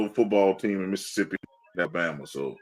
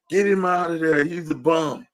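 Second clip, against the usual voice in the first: mock fan yell; 6 semitones higher than usual. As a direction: jeer like an angry fan. Fans yelling at quarterback.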